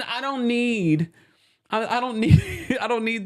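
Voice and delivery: "I don't need" said high and very slowly; high slow fed-up drawl; drawn-out exasperation. Real, not acted. Mocks the overdone accent.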